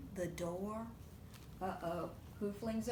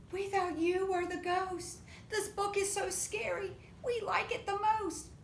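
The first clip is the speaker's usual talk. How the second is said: excited monkeys; 8 semitones higher than usual. Acted.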